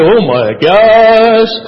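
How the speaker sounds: loud, drawn-out exasperated "oh my gosh"; slow, hammed-up waking groan; groaning morning-hater. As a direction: drag it out like someone dreading the morning. Mimics non-morning person waking up.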